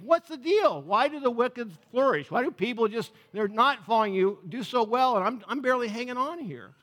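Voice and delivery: frustrated, incredulous questioning; exasperated complaint. Acted. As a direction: demand to know why it's unfair. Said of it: "you get to these points of saying".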